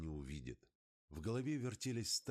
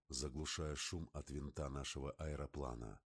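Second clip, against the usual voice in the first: plain reading voice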